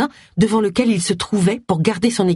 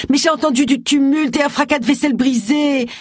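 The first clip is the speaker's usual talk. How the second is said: high-pitched, urgent plea; whiny, insistent self-justification; pleading; 9 semitones higher than usual. A narrator's reading. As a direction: protest desperately in your own defence. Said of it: "plaida Roy".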